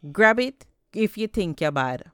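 loud cocky dare; taunting shout back. A narrator's reading. Anansi dares the pumpkin man, loud taunting shout. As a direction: yell back a cheeky challenge. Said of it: "and shouted back".

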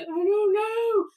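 high, slow, teary wail; mock-tearful, bewildered wail. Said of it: "I started crying".

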